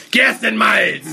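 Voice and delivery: high, loud shouted word. Shown as a demonstration. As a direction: yell the ingredient out. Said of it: "die fett gedruckt sind, schreien".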